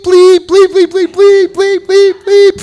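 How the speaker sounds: very high-pitched string of bleeps; censored cursing prayer. Retold or imitated. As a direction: squeal like a censor beep over cursing. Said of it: "start saying this prayer".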